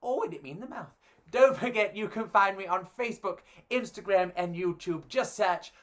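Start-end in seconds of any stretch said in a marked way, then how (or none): none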